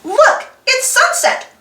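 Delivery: ordinary delivery, nothing marked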